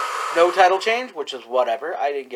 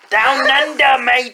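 mock Australian; 6 semitones higher, slower than usual. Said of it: "Australia is the Outback".

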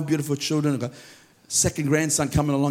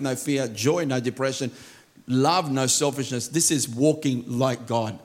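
ordinary talk

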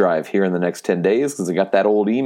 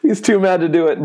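pitched way up, teasing his cohost; mock high teasing; 10 semitones higher, faster than usual. Host teasing grumpy cohost about sponsor read, mock high squeal. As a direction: squeal it like a playful jab.

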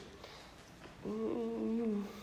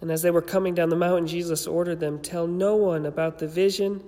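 louder, proclaimed gospel reading; steady, solemn liturgical reading; formal scripture reading; 4 dB louder than usual. Acted. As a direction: read it out to the congregation like scripture. Reads the gospel aloud.

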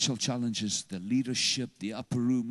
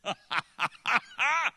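high-pitched laugh; 12 semitones higher than usual. Real, not acted. High laugh mid-sermon.

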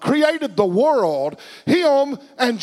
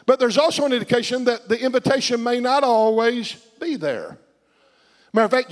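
ordinary talk

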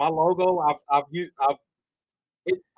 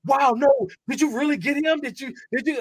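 high, eager disbelief; high amazed fan voice; excited fans; 11 semitones higher, 4 dB louder than usual. Retold or imitated. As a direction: imitate thrilled fans asking, pitched way up. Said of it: "half of my community was like".

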